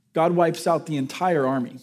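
ordinary talk

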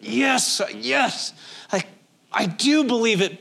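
believe it through clenched hurt; grieving sister insisting she still believes; strained Martha. Retold or imitated. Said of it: "I gotta feel like that's a".

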